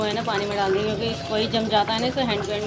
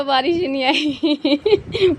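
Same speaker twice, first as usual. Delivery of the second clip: slow, laughing through the words; 5 semitones higher, 7 dB louder, much slower than usual. Real, not acted. Telling viewers the rain never came, laughing. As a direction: laugh it out as you say it.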